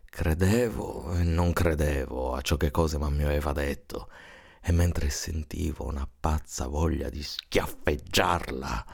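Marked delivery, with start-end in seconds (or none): none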